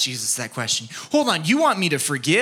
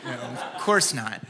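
ordinary talk

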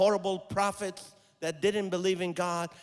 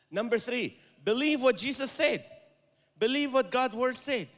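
loud, higher exhortation; preaching hard; 7 semitones higher, 4 dB louder than usual. Acted. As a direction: call it out to the hall. Preaching at full force.

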